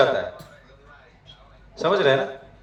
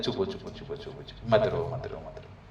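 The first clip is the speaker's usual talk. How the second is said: lower, much softer shushing lullaby; hushing a baby; 8 semitones lower, 12 dB quieter than usual. Retold or imitated. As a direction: soothe a crying baby, fading to nothing. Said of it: "बोलते हैं".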